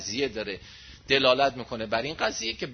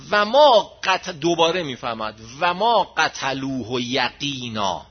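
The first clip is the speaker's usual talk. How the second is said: Quran recitation; 3 semitones lower, 5 dB louder, slower than usual. Acted. Verse recited.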